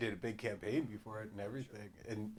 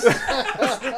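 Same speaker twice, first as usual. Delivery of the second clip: loud, high laughing words; 12 semitones higher, 15 dB louder than usual. Real, not acted. Group laughing at the joke.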